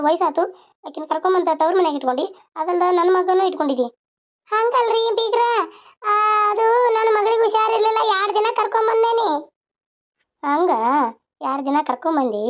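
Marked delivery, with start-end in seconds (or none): 4.5-9.3 a second character's voice, pitched higher and polite; lightly shifted higher voice for another relative; higher-voiced in-law. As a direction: answer the old in-law meekly, in a higher voice. In-law answers the grandmother.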